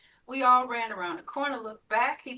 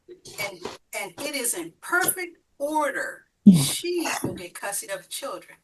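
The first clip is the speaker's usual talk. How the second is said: ordinary delivery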